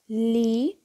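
"livro" split in two, li, long pause, vro; sílabas separadas. Shown as a demonstration. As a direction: break "livro" after li, each syllable drawn out. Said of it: "nós separamos assim". ("livro" separado em sílabas, com pausa: li... vro)

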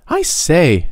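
put-on high, eager boyish interruption; boy breaking in. A narrator's reading. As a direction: cut in sharply like an impatient kid. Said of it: "he broke in".

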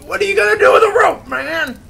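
fast, very high, loud shriek; exasperated yell. Retold or imitated. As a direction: holler down at a useless fireman. Acts out yelling at fireman.

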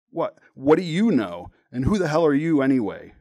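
sputtered defiance. A narrator's reading. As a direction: throw it back indignantly, tripping on the first word. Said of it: "I sputtered".

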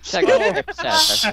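laughing hard. Real, not acted.

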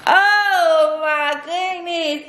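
high, long drawn-out exasperated wail; going crazy. Real, not acted. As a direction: stretch it out high, at wit's end. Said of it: "I'm about to go crazy".

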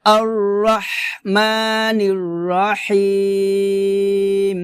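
slow, drawn-out tajweed recitation; measured chanting for students to repeat; Quran recitation demo. Shown as a demonstration. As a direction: recite slowly, holding the long vowels. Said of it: "আবার".

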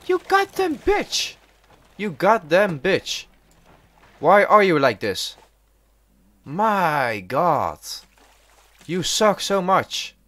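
high-pitched angry outburst; exasperated cursing. Real, not acted. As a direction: curse it out in a high, aggrieved voice. Cursing the game's shark.

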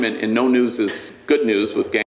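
ordinary delivery

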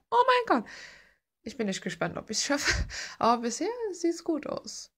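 usual speaking voice

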